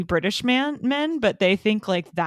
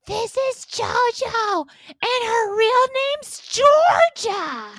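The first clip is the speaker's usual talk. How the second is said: high, slow, drawn-out gushing; drunken introduction; 13 semitones higher, 3 dB louder, slower than usual. Pretend. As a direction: play a tipsy friend showing someone off. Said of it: "if I was drunkenly introducing you".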